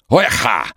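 high, loud guttural bark; strange guttural cry. Acted. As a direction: croak out a weird throat sound. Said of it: "издал странный гортанный звук".

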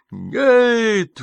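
high, loud howl of refusal; a desperate howling no. A narrator's reading. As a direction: wail the word out. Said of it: "взвыла она".

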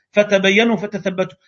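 usual speaking voice

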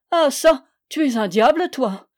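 exclamation aiguë et forte, mi-rieuse mi-fâchée; s'écrier, partagé entre rire et colère; moitié riant, moitié fâché. Acted. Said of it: "s'écria ... moitié riant, moitié fâché". (le jeune homme d'armes au frère, mi-riant mi-fâché)

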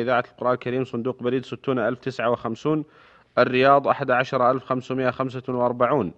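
formal radio announcer read; crisp, measured sign-off announcement; formal standard arabic. Acted. Radio host's closing announcement.

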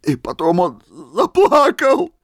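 high, loud, tearful exclaim; sob in the voice. A narrator's reading. Old man exclaiming through sobs about Misha weeping. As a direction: cry it out, choking up. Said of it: "с рыданием в голосе воскликнул".

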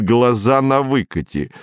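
plain reading voice

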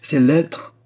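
high, loud heated outburst; vehement cry. A narrator's reading. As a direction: cry it out in a burst of temper. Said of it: "cria la reine avec emportement".

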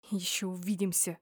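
soft sneering hiss. A narrator's reading. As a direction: hiss it as a quiet threat. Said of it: "прошипели мне".

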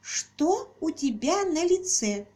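put-on high, slow, carefully spelled-out child voice; clear, deliberate child's voice. A narrator's reading. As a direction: enunciate each word like a curious little girl. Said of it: "произнесла ясно и отчётливо, выговаривая".

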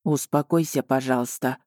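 low, calming male-character voice; soft and calm. A narrator's reading. Dragon soothes the sobbing heroine, soft and calm. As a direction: gently settle someone down. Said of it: "произнёс он мягко и спокойно".

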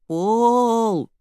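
high, loud, long-held dog's call; drawn-out howl. A narrator's reading. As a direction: howl the name like a dog. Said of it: "громко лаял и протяжно выл". (протяжный собачий вой)

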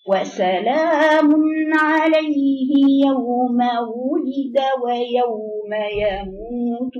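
slow melodic tajweed chant; drawn-out recitation for students; Quran recitation. Shown as a demonstration. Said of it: "teacher recites for repetition". (تلاوة مجودة بطيئة)

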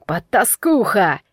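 slow, enraged name-calling; drawn-out angry insult; hurled insult. A narrator's reading. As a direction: throw the word like a blow. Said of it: "Голос быка влетел в комнату и с размаху ударил меня".

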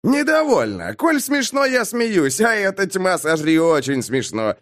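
high-pitched, gleeful retort; worked-up mocking protest; mirthful defiance. A narrator's reading. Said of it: "Бэкфилд согнулся от хохота".

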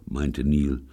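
ordinary delivery

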